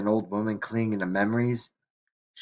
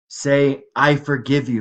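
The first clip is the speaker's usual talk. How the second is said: raised, louder insistent prompt; urgent pleading; 5 semitones higher, 6 dB louder than usual. A narrator's reading. Grandmother pressing Mike to say he forgives her, urgent pleading. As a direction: press him to repeat it. Grandmother dictates the words she needs.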